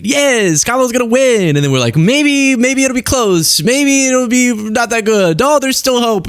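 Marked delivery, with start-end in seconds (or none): 0.0-1.5 high, chirpy mock optimism; mock excited. Mock election night optimism. 2.0-6.3 high, clinging mock worry; cling to fading hope, hammed-up; mock desperate hope. Retelling dwindling election hope.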